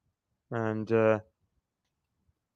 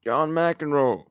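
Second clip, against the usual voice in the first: ordinary delivery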